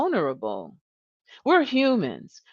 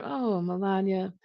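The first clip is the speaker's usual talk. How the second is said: sighing concern; 6 dB quieter than usual. Shown as a demonstration. Manager sensing something wrong, softly and with care. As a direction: breathe out a sigh, then ask softly with care.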